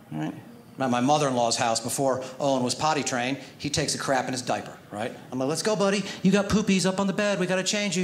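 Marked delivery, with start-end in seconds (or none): 5.5-8.0 a light, higher coaxing dad voice; jolly parent hurrying a toddler along. Retold or imitated. Dad coaxing his toddler toward a diaper change, lightly higher and coaxing. Dad talking to potty-training son.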